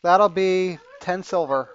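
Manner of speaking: name the price slowly and loudly; barmaid. Acted. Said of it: "she says".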